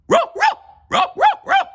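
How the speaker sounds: very high, loud cartoon barks; a cartoon dog barking at a kitten stuck in a tree. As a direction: bark up the tree, loud and urgent. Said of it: "stared up ... and barked".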